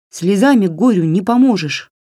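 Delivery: lightly voiced bossy doll; scolding doll. A narrator's reading. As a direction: chide her briskly, a little high. Doll scolds the crying girl.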